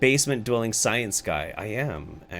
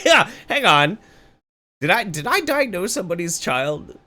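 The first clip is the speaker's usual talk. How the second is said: mock indignation; 7 semitones higher, 5 dB louder than usual. Mock outrage continues.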